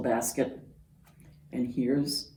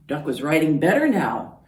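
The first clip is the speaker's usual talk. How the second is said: plain reading voice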